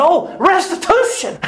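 high excited shout; excited exclaim. Retold or imitated. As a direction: burst out with joy. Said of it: "He said".

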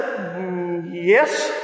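low, drawn-out "Mm, yes" lifting at the end; hesitant yes. Retold or imitated. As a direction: hum doubtfully, then venture a tentative yes. Unsure answer, knowing it was wrong.

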